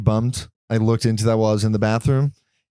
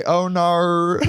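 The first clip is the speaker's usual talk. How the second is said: a deliberately bad, higher Australian "Oh no"; mock Australian dismay, slightly higher; terrible Australian accent; 5 semitones higher than usual. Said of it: "I have a terrible Australian accent".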